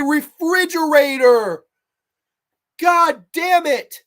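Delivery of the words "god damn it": slow, loud, high exasperated yell; exasperated shout. Real, not acted. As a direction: drag it out furious at the screen. Ranting at terrible movie scene.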